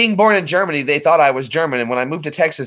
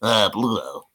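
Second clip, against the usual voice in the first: low muttering cartoon sailor; slow, garbled sailor mumble; Popeye; 4 semitones lower, 3 dB quieter, slower than usual. Shown as a demonstration. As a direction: mangle the words like a salty cartoon sailor. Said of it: "Popeye the Sailor Man?".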